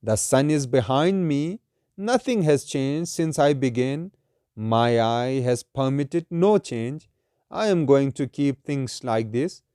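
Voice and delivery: slow, measured verse reading; solemn, deliberate poetry reading; poem recitation. Acted. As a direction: recite it slowly, drawing out each phrase.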